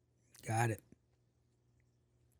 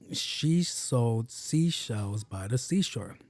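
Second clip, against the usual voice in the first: slow, drawn-out careful recital; slow tongue twister; 2 semitones lower, much slower than usual. Shown as a demonstration. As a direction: take the twister slowly, stretching each word. Easy tongue twister, first careful take.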